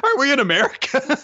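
very high, baffled question; mock bewilderment. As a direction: squeak it in comic confusion. Acting comic confusion about country names.

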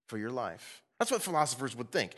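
ordinary delivery

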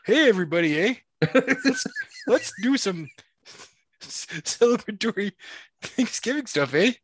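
high, hammed-up Canadian accent; mock Canadian. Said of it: "I'm just gonna be like".

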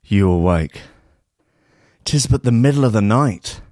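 ordinary talk

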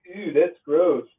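high, drawn-out mock disgust; imitating Gabe's disgust. Teasing a friend who dreaded beards, mock-disgusted voice. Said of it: "why he talked like that".

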